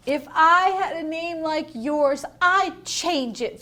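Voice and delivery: high, loud snooty jab, slowing; mean classmate sneering; snooty taunt. Acted. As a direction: sneer it at her. Victoria insulting Chrysanthemum.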